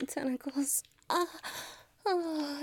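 ordinary delivery

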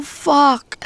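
usual speaking voice

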